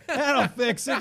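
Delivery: high, loud laughing repeat; laughing high stoner voice. Retold or imitated. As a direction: laugh through it, giddy and loud. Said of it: "I'm like".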